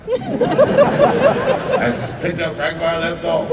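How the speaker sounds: ordinary talk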